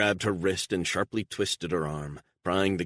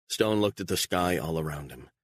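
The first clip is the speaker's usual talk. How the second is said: ordinary delivery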